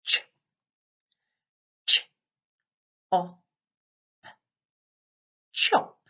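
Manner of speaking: ch, ch, op, then blend chop; sounding out words. Shown as a demonstration. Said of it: "special friends".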